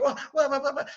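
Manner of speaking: high, rapid whoas; alarmed crowd. Acted. Staff as Beast comes over, alarmed. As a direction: babble in startled alarm. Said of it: "Everybody's like".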